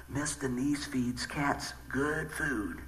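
low, hushed and drawn out; slow confidential quote; word-of-mouth voice. Acted. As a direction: pass the rumor along slowly, under your breath. Voicing the word among neighborhood animals.

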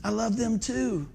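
slow, soft, tender voice; gentle mock whisper. Preacher voicing the Lord answering an upset believer, softly whispered manner. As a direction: say it gently and slowly, without a full whisper. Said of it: "And the Lord whispers".

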